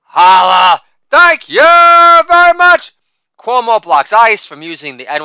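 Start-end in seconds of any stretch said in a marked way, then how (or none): 0.1-2.9 Holla barked loud, then thank you very much stretched slow and very high; shouted catchphrase. Acted. Comic to audience, hammed-up sign-off, loud high drawn-out shout. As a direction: belt the sign-off like a showman milking applause.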